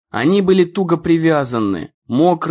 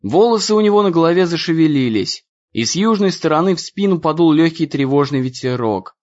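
usual speaking voice

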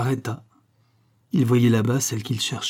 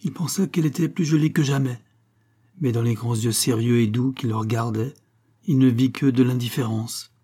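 ordinary delivery, nothing marked

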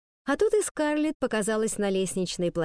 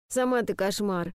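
grumbling. Acted. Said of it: "пробурчала Скарлетт".

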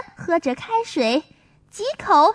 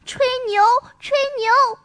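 high, singsong mocking kid; teasing child; 5 semitones higher than usual. Maomao mocks Songsong's boasting, high teasing child voice. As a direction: taunt him like a playground tease. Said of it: "毛毛刮着脸皮说".

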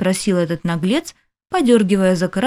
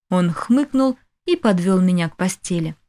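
ordinary delivery, nothing marked

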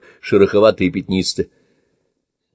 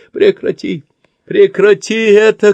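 high, loud desperate yell; shouting; 12 semitones higher, 6 dB louder, slower than usual. Acted. Tied-up old man yelling at his torturer. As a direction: scream at him to stop. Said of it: "кричит он".

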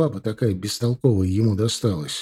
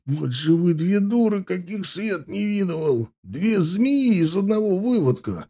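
contemptuous scolding; 8 semitones higher than usual. A narrator's reading. Husband berating wife.

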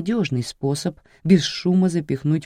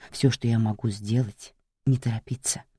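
тихо, низко, вкрадчиво; ласково-жуткий голос убийцы; зловещий шёпот маньяка; 4 semitones lower, 4 dB quieter than usual. Acted. Маньяк душит жертву. (тихий вкрадчивый голос маньяка)

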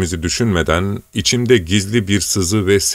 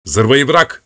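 sharp, higher, louder interruption; harsh cutoff; 6 semitones higher, 4 dB louder than usual. Acted. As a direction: cut him off hard. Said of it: "diye sertçe sözünü kestim".

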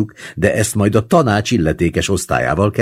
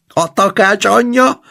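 high, loud exclamation; roaring yell; 5 dB louder than usual. A narrator's reading. Veszeli yells back before bursting out laughing, high loud yell. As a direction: yell it back at a much higher pitch. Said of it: "ordította Veszeli".

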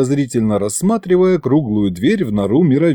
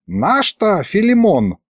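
higher, slow, musing delivery; thoughtful drawl; 8 semitones higher, slower than usual. A narrator's reading. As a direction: stretch it out as if recalling. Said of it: "задумчиво протянул Мировид". (задумчиво, протяжно)

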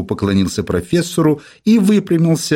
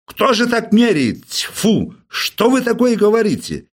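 indignant outburst; 10 semitones higher than usual. Acted. Professor outraged at reporter.

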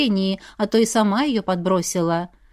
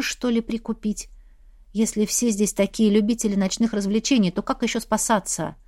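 ordinary delivery, nothing marked

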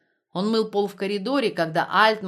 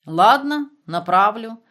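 loud grudging promise; still angry; 3 semitones higher, 8 dB louder, slower than usual. Acted. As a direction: promise it angrily. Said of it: "всё ещё сердито обещал". (сердито)

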